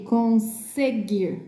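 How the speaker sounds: conseguir slowly with a hissed S; true S sound. Shown as a demonstration. Teacher giving the next example, slow conseguir with voiceless S. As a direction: split conseguir, leaning on "se". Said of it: "olha o som".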